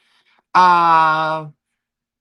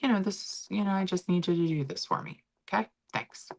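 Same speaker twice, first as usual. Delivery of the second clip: softer, gentle request; soft apology; 3 semitones higher, 8 dB quieter than usual. Retold or imitated. Wife apologizing to husband, soft gentle voice. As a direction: say it softly, smoothing things over. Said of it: "I'll just be like".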